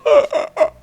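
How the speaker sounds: very high laughing gasp; mock ghost gasp. Ghost appearing in the game, a very high mock gasp. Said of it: "he's like".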